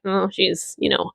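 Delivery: normal talking voice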